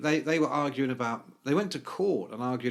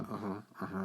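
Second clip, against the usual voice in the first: low, much softer mumbled uh-huhs into a phone; lower, hushed distracted phone voice; 8 semitones lower, 12 dB quieter, faster than usual. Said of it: "was like".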